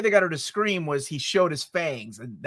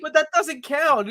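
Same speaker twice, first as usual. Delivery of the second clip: high, slow mock excuse; girlish excuse; 8 semitones higher than usual. Girl excuses the time with Steve, high slow mimicry. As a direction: imitate a girl explaining it away, pitched high.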